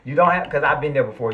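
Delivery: fired-up rant about putting up with nonsense; getting worked up. Real, not acted. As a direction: let real anger creep into the voice. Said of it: "I'm getting worked up".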